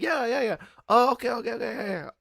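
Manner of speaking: high nervous relief; squeaky relieved agreement; relieved yeahs. Retold or imitated. Relieved self voiced high.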